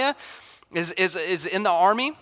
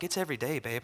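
much softer, offhand "it's every day"; wife casually answering her husband's question, much softer; wife's flat reply; 5 semitones lower, 11 dB quieter, faster than usual. Retold or imitated. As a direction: drop it quietly like it's obvious. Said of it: "She was like".

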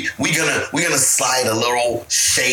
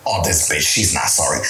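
much lower, scornful mocking voice; mock catty voice; 11 semitones lower than usual. Coworker imitated mocking a fake apology, low and scornful.

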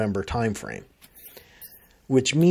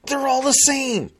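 exasperated rant; 8 semitones higher, 3 dB louder than usual. Real, not acted. Complaining rant about identical phones.

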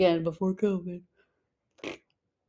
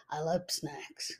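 put-on soft, slow whisper of a small child; little girl voice; 3 semitones lower, 12 dB quieter, slower than usual. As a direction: whisper it slowly like a little kid in love with snacks. Little Rosie's answer voiced.

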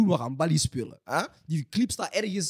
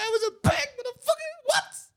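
shrill gloating yell, pitch way up; ecstatic yelling; slower than usual. Real, not acted. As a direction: scream it out in triumph. Cry shout, very high pitch. (juichend schreeuwen)

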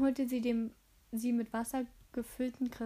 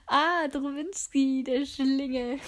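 loud, drawn-out exclamation; playful outcry at being tricked; loud mock scolding; 2 semitones higher, 8 dB louder, slower than usual. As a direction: call it out slowly and loudly, amused at the rascal. Mother exclaims at the uncovered prank.

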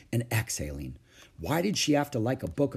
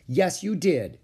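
higher-pitched insistent contradiction; 6 semitones higher, 3 dB louder, slower than usual. A narrator's reading. Ramona contradicting her sister about the skipped word, insistent. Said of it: "insisted Ramona".

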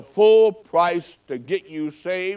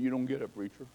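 tearful; 5 semitones lower, 13 dB quieter, faster than usual. Retold or imitated. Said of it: "He started crying".

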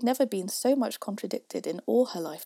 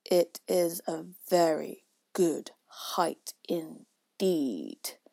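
very slow, weighty indignation; angry; 3 semitones lower, 4 dB louder, much slower than usual. A narrator's reading. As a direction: separate every word in offence. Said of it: "said the caterpillar angrily".

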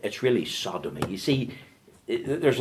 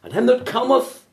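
"And him that cometh" cried high and loud; ringing proclamation; 5 dB louder than usual. Shown as a demonstration. Preacher urging sinners to come, ringing cry. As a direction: lift "cometh" up like a call. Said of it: "Underline the word".

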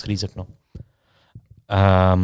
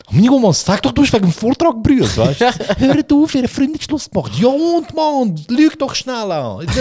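much higher, excited pleading; a student begging a crying classmate to lie; exasperated pleading; 12 semitones higher, 3 dB louder than usual. Retold or imitated. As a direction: beg her high-pitched to fib for him. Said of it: "gehen zu ihr, so".

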